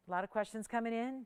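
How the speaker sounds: ordinary talk, nothing marked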